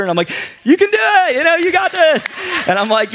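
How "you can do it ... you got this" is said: hammed-up high-pitched cheering; over-eager supporter. Stranded driver cheering on the frustrated repairman, hammed-up supportive. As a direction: squeal encouragement like an overkeen cheerleader. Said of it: "trying to be the supporter".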